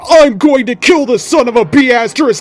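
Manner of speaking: high, loud furious shouting; rage scream. Acted. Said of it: "I screamed".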